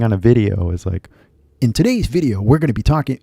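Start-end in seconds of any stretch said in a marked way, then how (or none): 1.6-3.2 YouTuber intro voice. Retold or imitated. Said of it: "なんか声が変わるじゃん".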